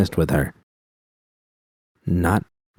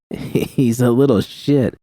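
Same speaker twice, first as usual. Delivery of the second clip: grinning exclaim; 4 semitones higher, slower than usual. A narrator's reading. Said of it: "V exclaimed with a grin".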